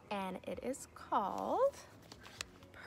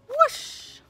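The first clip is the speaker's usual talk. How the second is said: much higher, much louder whoosh; excited whoosh; 14 dB louder than usual. Acted. Teacher reading the flying moment to her class, excited whoosh. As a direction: let it soar like takeoff. Climax of story, everyone flies.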